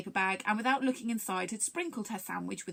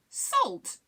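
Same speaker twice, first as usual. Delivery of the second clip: high, loud one-word query; questioning; 4 dB louder than usual. A narrator's reading. Rusin asks Longstint about the idea, puzzled. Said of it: "asked Rusin".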